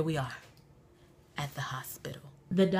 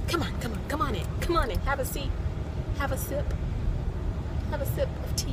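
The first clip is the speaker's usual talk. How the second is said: hostess welcome; 6 semitones higher, 4 dB quieter, faster than usual. Pretend. Mock class welcome.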